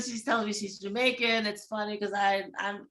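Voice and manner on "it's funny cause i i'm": ordinary talk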